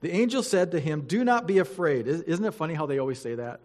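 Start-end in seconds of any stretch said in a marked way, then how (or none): 0.0-2.1 louder, formal Bible reading voice; scripture reading. Acted. Pastor reading Luke to the church, formal and solemn. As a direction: read it out to the congregation with weight.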